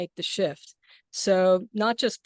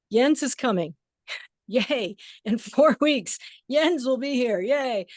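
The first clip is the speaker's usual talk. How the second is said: higher, gleeful announcement with a laugh; real delighted yay, pitched up; excited cheering; 4 semitones higher, 4 dB louder than usual. Real, not acted. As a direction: cheer the good news, laughing. Cheering a friend's coming visit.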